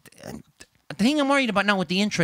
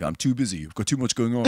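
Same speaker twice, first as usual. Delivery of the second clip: friend's voice; 7 semitones lower than usual. Retold or imitated. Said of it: "he's like".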